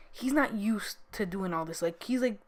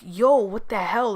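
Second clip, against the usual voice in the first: loud, slow retold outburst; mock yell at a friend who caved; louder, drawn-out angry yo at the friend; 2 semitones higher, 9 dB louder, slower than usual. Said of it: "I got really angry at him".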